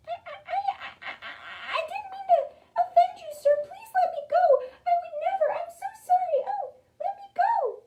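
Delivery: loud, very high stammering squeak; pleading mouse. A narrator's reading. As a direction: stammer and beg in a frantic tiny voice. Mouse begs to be freed.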